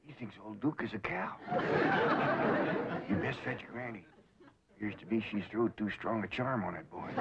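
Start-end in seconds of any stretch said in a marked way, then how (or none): 1.4-3.0 high loud breathy cackle. Real, not acted. Uncle laughs at the charm mix-up, high wheezing cackle. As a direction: burst into a shrill wheeze of laughter.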